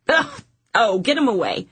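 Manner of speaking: high, playful ugh of disgust. Acted. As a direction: recoil from the smell. Disgust at smelly hands.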